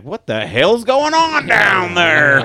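loud, drawn-out mock coach yell from the sidelines. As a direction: bellow it slowly like an angry football coach. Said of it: "the new coach is like".

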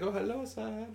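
put-on soft, high-pitched African-accented greeting; polite African-accented shop clerk. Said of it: "like, African accent".

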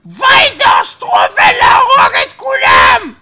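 loud, slow furious yelling; angry shout. Acted. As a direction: scream it out in rage. Comic rant against Russians.

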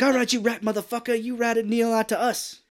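high, loud tough-guy confrontation; angry movie accusation. Retold or imitated. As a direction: act out the gangster's accusing outburst. Acted movie confrontation quote.

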